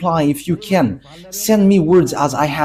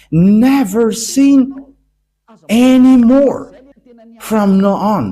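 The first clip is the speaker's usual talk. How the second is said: slow, loud, rising proclamation of Never sin anymore; preaching shout; 5 semitones higher, 5 dB louder, slower than usual. Acted. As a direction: cry it out to the congregation, stretched.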